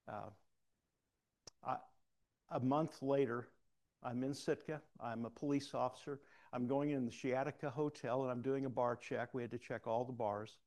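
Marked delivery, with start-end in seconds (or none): none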